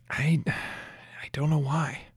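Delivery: unsure, whispered and slow; hushed confusion. Acted. Lucia quietly admitting to Resin she can't remember, whispered. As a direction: barely get it out, lost.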